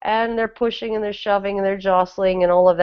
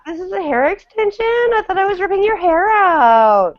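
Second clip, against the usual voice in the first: put-on very high-pitched Valley Girl uptalk; outraged mock Valley Girl squeal in uptalk; uptalk accent; 10 semitones higher than usual. Acting out hair-pulling in a catfight, squealing uptalk. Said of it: "I could do the uptalk accent".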